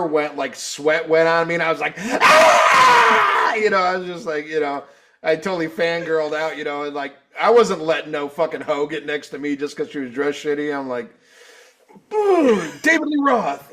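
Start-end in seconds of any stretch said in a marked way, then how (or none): 2.0-3.5 loud, very high ecstatic scream; fangirl scream. Retold or imitated. Fan sweated on by rock star, mock fangirl scream. 12.1-13.7 high, loud punchy exclamation; triumphant mock outburst. Fan shoving past to reach the star, mock triumphant.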